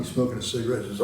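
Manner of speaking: ordinary delivery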